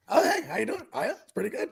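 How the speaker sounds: lilting, soft phone-pleasant greeting; affected chipper politeness; work voice. Retold or imitated. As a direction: put on your polite office small-talk voice. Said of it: "why is my work voice like that".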